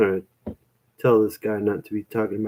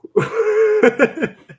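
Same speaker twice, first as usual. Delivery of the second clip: high-pitched burst of real laughter; helpless laugh; 4 dB louder than usual. Real, not acted. Cracks up after "how?.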